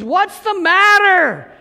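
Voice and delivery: high, loud and slow, called from the doorway; big brother calling out from the door; big brother's reply. Retold or imitated. Big brother answering his yelling little brother, loud. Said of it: "and he says".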